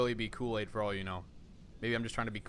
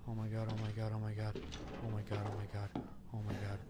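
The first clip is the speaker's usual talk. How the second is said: soft, low, repeated under the breath; hushed dread; 2 semitones lower, 10 dB quieter than usual. Real, not acted. Player sure he'll be killed, murmuring in hushed dread. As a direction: keep it down, as if hiding.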